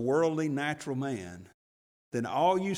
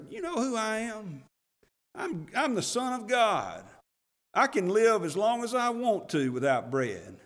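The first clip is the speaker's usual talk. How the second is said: mock indignant Jesus; 8 semitones higher than usual. Jesus retort he didn't give.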